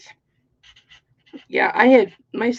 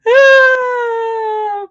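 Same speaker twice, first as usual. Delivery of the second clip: high loud mock scream of shock; retold scream; 13 semitones higher, 12 dB louder than usual. Mom recalling finding her son's cuts, screaming. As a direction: shriek like she just saw the cut quilt. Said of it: "I screamed the top of my lungs".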